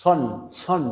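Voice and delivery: সন with a heavy S between "s" and "sh"; in-between Sad sound. Shown as a demonstration. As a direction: say সন as a thick, rounded "sawn". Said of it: "এর মাঝামাঝি একটা sound আসবে সদের".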